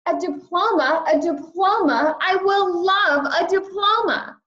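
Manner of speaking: put-on high, excited child squeal of joy; bouncing with childish delight; thrilled shout. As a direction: gush over the word diploma, thrilled. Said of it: "I shouted".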